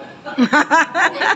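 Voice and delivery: high bright laugh at the punchline; a short real laugh; burst of laughter. Real, not acted. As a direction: laugh out at your own story. Laughs at own story.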